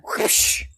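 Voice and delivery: breathy whip-crack whoosh. Retold or imitated. Whip sound while Jesus clears the temple. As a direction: crack the whip with your mouth.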